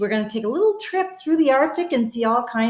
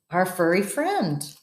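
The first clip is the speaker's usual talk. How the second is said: usual speaking voice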